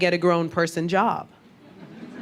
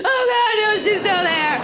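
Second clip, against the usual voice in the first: high-pitched, loud horror-movie whimper; mock panic; 12 semitones higher, 4 dB louder than usual. Caught on the bus by a hated girl, mock panic. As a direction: wail it in hammed-up dread.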